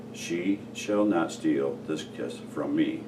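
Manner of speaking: usual speaking voice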